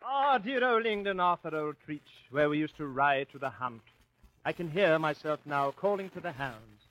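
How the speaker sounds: put-on light English hunting-gent accent; a fond English squire's accent; mock English accent. [name] remembers the hunt, light mock English accent. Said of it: "What's wrong with my English accent?".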